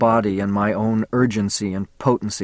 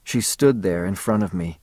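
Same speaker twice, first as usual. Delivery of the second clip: usual speaking voice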